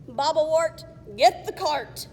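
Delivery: loud goblin command; goblin bark. Acted. Goblin orders.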